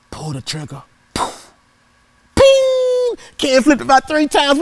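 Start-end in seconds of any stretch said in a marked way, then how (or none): 2.4-3.2 high, sharp metallic ping; ping sound. Retold or imitated. Boy imitating the BB striking the can, high ping. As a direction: ring it out like a pellet hitting tin.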